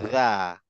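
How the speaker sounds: দা with the tongue pushed out between the teeth, like Arabic dhal. Shown as a demonstration. Teacher demonstrating দা to students with tongue out between teeth. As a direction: say দা with the tip of the tongue out past the teeth. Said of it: "দা যখন বলবো তখন জিভটা বাইরে নিয়ে আসবো".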